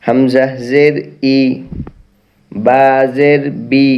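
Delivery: recitation drill. Shown as a demonstration. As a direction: say each letter with a clear, deliberate recitation.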